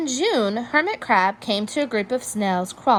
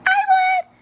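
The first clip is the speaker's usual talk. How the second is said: much higher, louder tiny star voice; small creature's eager reply; little sea star; 6 dB louder than usual. A narrator's reading. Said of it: "signaled a little sea star".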